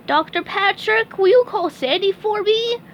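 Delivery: very high squeaky cartoon sponge; chirpy high-pitched sponge, cheerful; SpongeBob voice. SpongeBob praising Doctor Patrick, high squeaky sponge voice.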